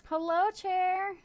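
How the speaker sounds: high, slow sing-song hello; sing-song greeting. Real, not acted. Streamer greeting friend Chair in a high sing-song voice.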